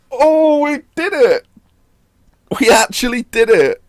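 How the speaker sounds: high, joyful disbelief; overjoyed disbelief. Real, not acted. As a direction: savour the win with wonder. Celebrating league win.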